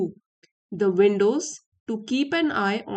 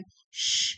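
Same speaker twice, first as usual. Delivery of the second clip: hushing shh. Acted. Hushing someone in the story.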